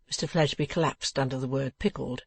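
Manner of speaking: ordinary delivery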